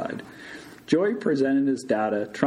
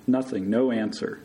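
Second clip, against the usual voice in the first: usual speaking voice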